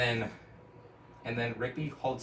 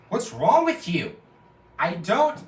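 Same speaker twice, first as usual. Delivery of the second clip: high, loud and slow exasperated yell; hammed-up exasperated shout; 7 semitones higher, 10 dB louder, slower than usual. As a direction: shout at the friend who won't stop. Friend yells at singing Rigby.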